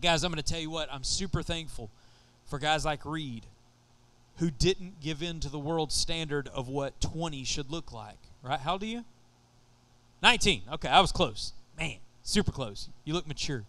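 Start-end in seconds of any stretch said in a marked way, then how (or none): none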